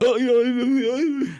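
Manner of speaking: high, loud, slow uneasy moan of yeah; strange recoiling noise. Retold or imitated. People react to Neuralink with a loud, drawn-out uneasy yeah. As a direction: drag out a queasy, fearful yeah. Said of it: "start making almost strange noises".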